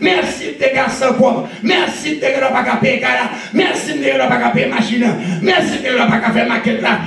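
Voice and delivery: sermon crié, voix haute et forte; prêche enflammé. Acted. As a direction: exhorte l'église à pleine voix, en montant. Preaching at full force. (prédication à plein volume)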